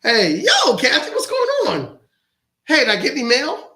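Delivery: loud, high imitation of a sister; sister calling. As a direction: holler a big, high phone greeting as the sister. Acting sister's phone call.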